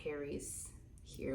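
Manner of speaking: slow whisper; secretive whisper; hushed. Real, not acted. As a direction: whisper it slowly, a little giddy. Intimate detail, hushed.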